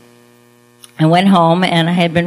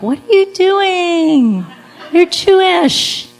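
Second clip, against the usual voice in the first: mock pleading friends; 7 semitones higher than usual. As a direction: play the frantic family friends, overdone and higher.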